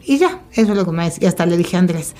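ordinary talk, nothing marked

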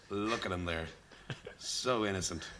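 lightly voiced, hushed golf commentator; a touch of low, soft commentary. Bandmate retelling odd commentary on a child, understated golf commentator. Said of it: "And the commentator just says".

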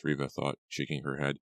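plain reading voice

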